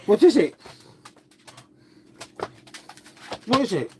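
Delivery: talking to the dog. Real, not acted. Cooing at the dog.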